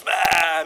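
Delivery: put-on loud bleated baby wail; imitated baby cry. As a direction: wail like a demanding infant. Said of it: "das schreit immer". (Babygeschrei nachgemacht, laut)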